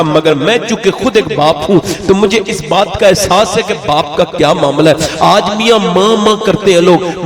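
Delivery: ordinary delivery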